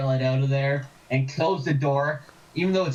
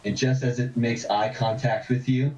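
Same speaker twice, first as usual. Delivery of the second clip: game master narration; 5 semitones lower than usual. A narrator's reading. GM describes vision.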